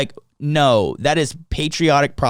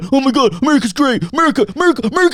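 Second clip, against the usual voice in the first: high, loud chanting of America; mocking patriot cheer; 10 semitones higher, 4 dB louder, much faster than usual. Mocking patriots chanting America, high and loud. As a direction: squeal it like a giddy flag-waver. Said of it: "a way to be like".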